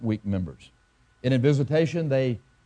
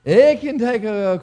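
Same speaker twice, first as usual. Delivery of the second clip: much higher and louder, slowed by laughter; preacher cracking up while reading scripture; laughing burst; 10 semitones higher, 8 dB louder, slower than usual. Real, not acted. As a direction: break up laughing as the verse starts. Laughing through the verse.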